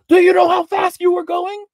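high, loud shout at a speeding driver; imitated trooper yelling. Trooper shouting at the speeding driver, furious yell. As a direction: bark it like an angry state trooper. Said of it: "he starts screaming at me".

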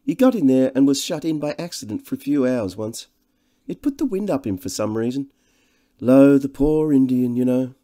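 high, loud, gushing society man; jovial host. Acted. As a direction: introduce your old friend with great fuss. Devine's lines in the novel.

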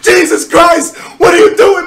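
loud high disbelieving yell; stunned shout. Real, not acted. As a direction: cry out in shock at the money. Reacting to huge donation.

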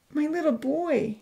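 high, plaintive mother's voice; high voice on the edge of tears; tearful mother. Acted. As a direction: speak as tears well up. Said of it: "tears welling up in her dark brown eyes".

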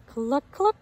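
a hint of a clucking hen; hen's cluck. A narrator's reading. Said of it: "says Mama Hen".

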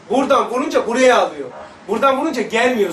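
ordinary talk, nothing marked

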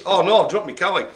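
usual speaking voice

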